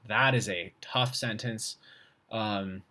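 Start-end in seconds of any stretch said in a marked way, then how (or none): none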